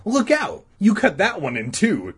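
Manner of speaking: put-on high, loud kid's warning. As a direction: call out a sudden warning to a friend. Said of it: "Todd warned".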